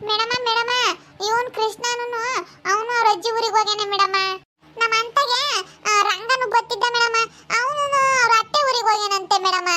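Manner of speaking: put-on high-pitched little-kid voice; eager tattling child. Child telling madam who went to the village, high childish voice. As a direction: report to teacher like a small child. Children report absent friends.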